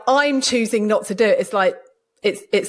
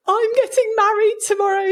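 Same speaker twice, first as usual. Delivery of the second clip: mock sobbing; 12 semitones higher, 3 dB louder than usual.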